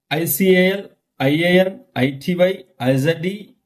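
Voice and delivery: ordinary delivery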